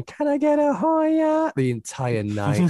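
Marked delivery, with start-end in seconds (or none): 0.0-1.5 put-on high, slow drawn-out drunk wail; drunk screaming. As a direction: mimic a drunk girl yelling for a drink. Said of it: "really drunk, just screaming".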